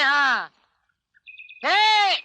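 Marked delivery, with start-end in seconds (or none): 1.6-2.1 high, drawn-out warning call. Acted. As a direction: hail someone from a distance to warn them. Calling out warning to couple.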